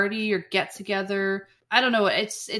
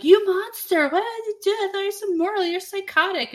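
outraged Bridget; 11 semitones higher than usual. Retold or imitated. Bridget to Mark after the fight, high mock outrage. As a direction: scold him like a horrified girlfriend. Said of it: "Bridget's like".

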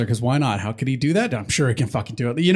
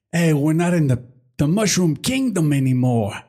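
terrible Tony Soprano; 3 semitones higher than usual. Retold or imitated. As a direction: do a bad Sopranos boss. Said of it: "My terrible Tony Soprano impression".

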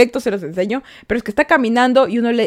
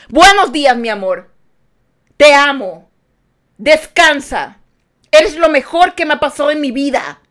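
louder, higher, slow and emphatic morning greeting; loud loving greeting; 3 semitones higher, 4 dB louder than usual. Shown as a demonstration. Greeting a grumpy person with loud firm love. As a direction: proclaim your love at full volume.

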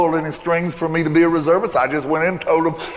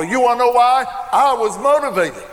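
excited refrain; 6 semitones higher than usual. Acted. Preacher's building refrain.

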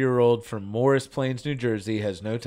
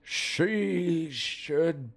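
put-on slow, creaky old man reading; 7 dB quieter, much slower than usual. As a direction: read it like a grumpy old man. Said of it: "Whoa, your voice totally changed".

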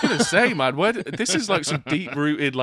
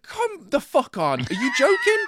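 high, incredulous mock outrage; exasperated; 11 semitones higher than usual. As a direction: squeal it in disbelief, really overdone. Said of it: "It's like".

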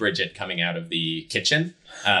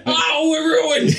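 high, drawn-out hammed-up howl; despairing wail; 7 dB louder, much slower than usual. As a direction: wail it slowly in mock despair. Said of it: "he just goes like".

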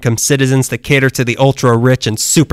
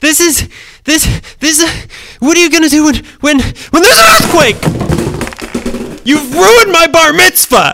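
hysterical shriek; 14 semitones higher than usual. Acted. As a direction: wail it like a meltdown. Hysterical meltdown over earthquake.

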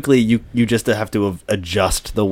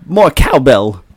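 Christopher Walken impression; 4 semitones higher, 6 dB louder, slower than usual. Shown as a demonstration. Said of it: "stock impression".

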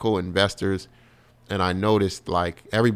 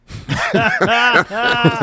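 a big cackle at his own punchline; loud high laugh; 8 dB louder than usual. Real, not acted. Comedian cracks up at his own Ithaca story, big laugh.

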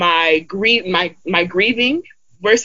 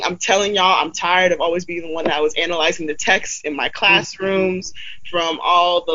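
ordinary talk

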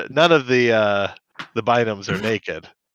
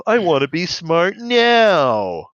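higher, slow, drawn-out NOW; impatient kid; 6 semitones higher, 3 dB louder, much slower than usual. Acted. Enzo refusing to wait to grow up, acted impatient whine. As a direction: stretch the last word like an impatient child.

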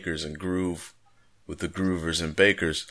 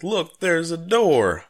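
high, loud, drawn-out cry of discovery; hammed-up cartoon exclamation; excited cartoon character; 11 semitones higher, 5 dB louder, slower than usual. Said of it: "he's like".

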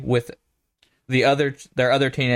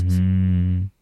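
low seductive hum; a hint of a mock seductive purr; sultry voice; 7 semitones lower than usual. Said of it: "my sultry voice".

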